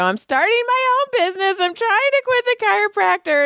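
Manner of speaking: high, whiny outburst; mock wail. [name] explains to Eric why she's tired, mock wail. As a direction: blurt it in a put-on strained high voice. Acts her overwrought reply.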